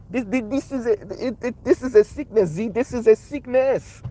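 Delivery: high Haitian-accent imitation, feeling for the words. Shown as a demonstration. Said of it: "in a Haitian accent".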